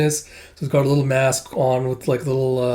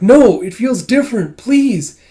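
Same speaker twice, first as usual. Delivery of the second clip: high, strained little voice, louder; pleading like an anxious small creature; Kippa's voice; 10 semitones higher, 9 dB louder, slower than usual. Retold or imitated. Kippa begging Master Ren to help Miss, high pleading voice. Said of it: "It's like".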